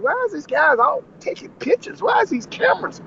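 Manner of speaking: high-pitched puzzled questioning; retold bewilderment. Cook asking about the cameras, bewildered.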